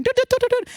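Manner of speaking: high sung dialling beeps; phone dial noise. Pretend. Host mocking herself dialling takeaway, high beeps. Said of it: "calling on the phone and going".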